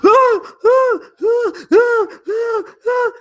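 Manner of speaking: high falsetto mock moans; mock moaning. Imitating sex noises.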